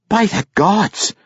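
high, drawn-out exasperation; exasperated dwarf mother's high exclamation; exasperated cry. A narrator's reading. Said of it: "cried the exasperated woman".

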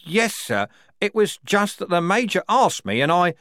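high, flustered start; bewildered. A narrator's reading. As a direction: begin to explain in confusion. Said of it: "Strappy began, bewildered".

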